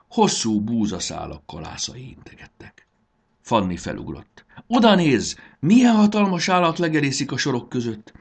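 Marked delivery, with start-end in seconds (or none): 4.7-5.3 high, loud startled cry; excited shout. A narrator's reading. Fanny jumps up and shouts to Marci, high and loud. 5.6-8.1 high, loud astonishment; amazed cry. A narrator's reading. Fanny exclaims at the huge animal, high and loud. Startled at a big creature.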